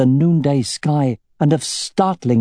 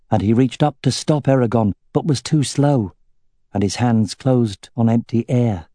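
ordinary delivery

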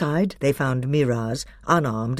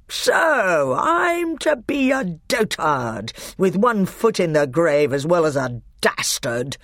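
much higher, slow louder roar; 5 semitones higher, 4 dB louder than usual. A narrator's reading. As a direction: roar it in wounded pride. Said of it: "roared Miraz".

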